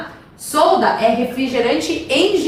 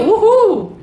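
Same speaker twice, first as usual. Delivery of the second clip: high, rising playful cheer; excited whoop; 8 semitones higher, 4 dB louder than usual. Pretend. Teacher cheers reaching thirty thousand feet, excited whoop. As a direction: whoop it up for take-off.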